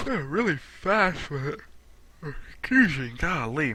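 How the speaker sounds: yawning through the words; slow, stretched yawn mid-sentence. Real, not acted. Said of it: "Of course I'm yawning".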